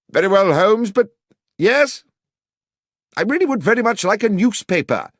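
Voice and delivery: high, loud call. A narrator's reading. Watson calls back to Holmes through the door, loud.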